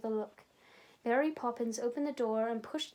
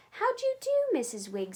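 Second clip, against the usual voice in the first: politely; 9 semitones higher, 4 dB louder, faster than usual. A narrator's reading. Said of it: "said Jane politely".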